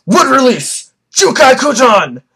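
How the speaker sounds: high, forceful battle cry; Madara. Retold or imitated. Vlogger acting out Madara unleashing his jutsu, high shout. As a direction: shout the attack name with hammed-up force. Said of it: "Madara goes".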